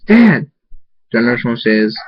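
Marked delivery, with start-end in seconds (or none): 0.1-0.5 high, loud cry out; screamed call. A narrator's reading. Daughter calls to her dad down the hallway, screaming. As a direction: scream out for a parent. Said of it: "Crystal screams".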